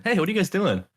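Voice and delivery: high chirpy call, a touch of Southern lady; lightly voiced chatty Southern woman; Southern women. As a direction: mimic a group of Southern women greeting. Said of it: "it's all women".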